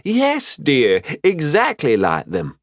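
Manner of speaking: high yes, then slowing down; fond approval. Acted. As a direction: indulgent mentor praising a pupil. Mafira approves excited pupil.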